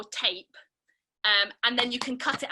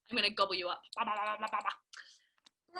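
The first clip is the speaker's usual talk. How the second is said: much softer, fading into a whisper; playful gobbling whisper; 5 semitones lower, 8 dB quieter than usual. Pretend. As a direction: gobble them up quietly like a game. Pretend gobbling up the shapes.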